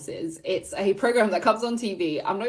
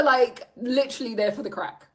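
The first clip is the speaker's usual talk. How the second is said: usual speaking voice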